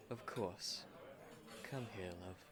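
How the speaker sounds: soft intimate murmur. Acted. Husband pulling his wife close on their honeymoon, hushed and tender.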